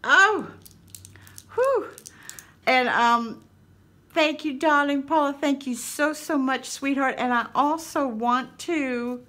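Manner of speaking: high, recovering from hard laughter; giddy, high-pitched real delight; tears of joy. Real, not acted. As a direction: gush thanks while getting over a laughing fit. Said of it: "tears of joy in my eyes".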